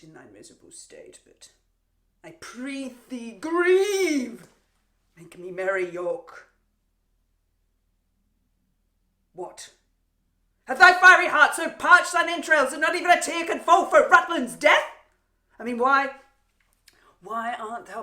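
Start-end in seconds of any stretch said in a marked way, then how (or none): 2.2-4.5 slow, swelling cry on grieve; drawn-out theatrical goading. Acted. Queen goads captive to weep. 10.6-16.1 loud, high, furious questioning; theatrical outburst of exasperation; raging taunt. Acted. Queen rages at silent captive.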